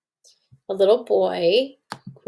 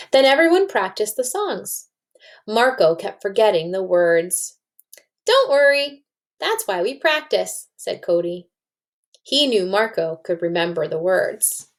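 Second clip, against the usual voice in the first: lively read-aloud to a class, voicing the kids; story narration. A narrator's reading. Teacher reading a story to her class, lively read-aloud. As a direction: read it like a picture book to children.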